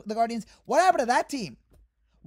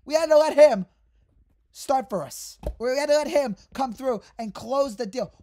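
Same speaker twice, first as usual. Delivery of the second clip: normal talking voice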